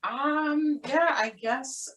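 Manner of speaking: high, small, hesitant voice; reluctant agreement. Retold or imitated. Retold reluctant answer to director.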